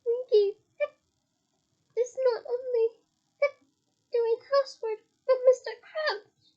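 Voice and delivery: a tiny elf bristling with pride; high indignant elf voice; indignant squeak. A narrator's reading. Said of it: "Winky squeaked indignantly".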